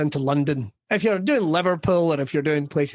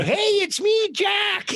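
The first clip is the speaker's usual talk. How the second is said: much higher mock old man, cheery; old expat greeting; much slower than usual. Eighty-year-old expat greeting the comedian, much higher mock voice. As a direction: pipe up high like a chuffed old man.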